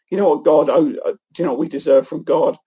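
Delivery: normal talking voice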